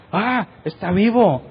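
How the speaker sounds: exclamación de asombro, aguda y alargada; asombro imitado. Retold or imitated. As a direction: imita la sorpresa de quien lo creía muerto. Reacción al ver vivo al muchacho. (asombro agudo, actuado)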